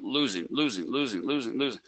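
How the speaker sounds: a sinking chant of losing that fades almost to nothing; dwindling losing. Retold or imitated. Money draining away on a trade.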